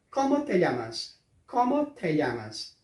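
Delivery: "¿Cómo te llamas?" com o LL como LH; espanhol devagar. Shown as a demonstration. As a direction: diga em espanhol, pausado. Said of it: "a pergunta em espanhol fica". (pergunta em espanhol dita devagar)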